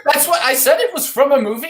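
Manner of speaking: high, indignant defence; indignant protest. Acted. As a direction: protest your innocence loudly. Defending against the accusation.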